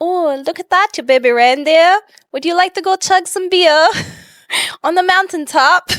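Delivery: hammed-up accent attempt with tongue-twisted vowels; Baby Reindeer accent. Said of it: "give me a baby reindeer accent".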